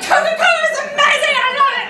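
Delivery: high, loud, giddy gush. Retold or imitated. Narrator gushing about Coca-Cola, high giddy rave. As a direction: rave like a kid hooked on soda. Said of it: "I was like".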